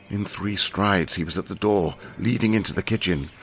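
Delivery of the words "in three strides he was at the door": ordinary delivery, nothing marked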